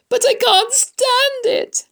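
very high, loud, drawn-out cry; distraught shout. A narrator's reading. Wilbur cannot stand losing Charlotte, distraught shout. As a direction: shout it in despair. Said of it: "shouted Wilbur".

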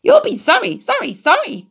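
very high, sing-song goose call; honking goose cry. A narrator's reading. Goose urging Wilbur to dodge, high honking cry. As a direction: squawk the orders like a flustered goose. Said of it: "called the goose".

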